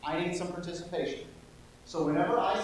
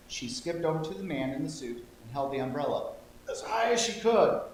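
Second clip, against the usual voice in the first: ordinary delivery, nothing marked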